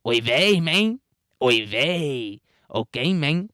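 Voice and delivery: sing-song mock Mexican accent, rising at phrase ends; caricatured Mexican accent with big pitch swoops. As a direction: put on an exaggerated, lilting Mexican accent. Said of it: "with a stereotypical Mexican accent".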